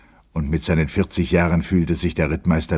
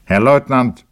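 loud, raised formal call; stern address; 8 semitones higher, 8 dB louder than usual. A narrator's reading. Captain addresses the shouting lieutenant, stern address. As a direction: call the junior officer to order.

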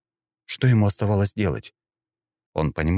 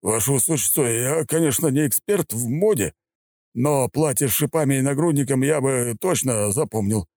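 lightly voiced, dry officer; polite but doubtful; wry major; 9 semitones higher than usual. A narrator's reading. Major character voice.

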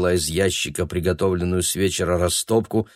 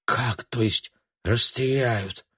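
soft, slow, higher-pitched echo; uncomprehending; 4 semitones higher, 4 dB quieter, slower than usual. A narrator's reading. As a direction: repeat it in hushed bewilderment. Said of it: "ничего не понимая, повторила она".

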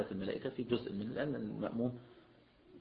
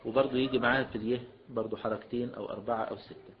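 normal talking voice

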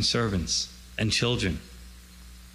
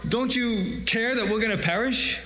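higher-pitched panicked plea, acted out; retold cry of alarm, pitched up; mock frantic; 8 semitones higher than usual. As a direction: imitate frantic disciples pleading for help. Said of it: "start to get crazy and frantic".

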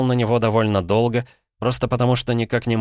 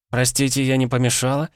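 higher, polite female voice; a woman interrupting politely; woman's voice; 6 semitones higher than usual. A narrator's reading. Woman at the gate interrupting two men, lightly voiced higher. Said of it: "знакомый женский голос".

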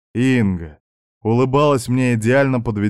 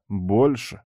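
reluctant concession. Acted. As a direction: concede it, without any lift. Narrator privately concedes her point.

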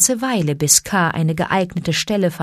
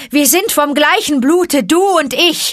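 high, loud howled cry; snake call howled; 9 semitones higher, 5 dB louder than usual. A narrator's reading. Mowgli howls the snake cry for help, high and loud. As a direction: howl it into the night. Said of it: "heulte er ... den Hilferuf der Schlangen".

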